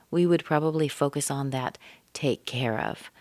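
ordinary talk, nothing marked

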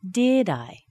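"Did" high, "I" dropping; falling intonation. Shown as a demonstration. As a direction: start DID high and let I fall. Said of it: "now with falling intonation".

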